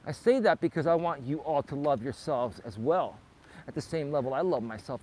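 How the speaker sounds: normal talking voice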